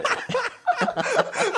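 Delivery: shrieking laugh, very high; high burst of laughter. Real, not acted. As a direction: let out a high cackle of laughter.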